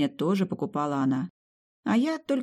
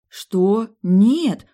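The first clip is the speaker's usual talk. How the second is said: резкий громкий возглас растерянности; выкрикнуть от неожиданности; ошарашенно; 6 semitones higher, 10 dB louder than usual. Acted. Героиня ошарашена вопросом о беременности. (ошеломлённый громкий возглас)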